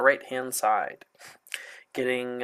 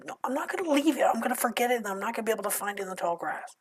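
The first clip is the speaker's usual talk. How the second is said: high, fast hushed reply; 7 semitones higher, 7 dB quieter, much faster than usual. Acted. GM voices a reply in character.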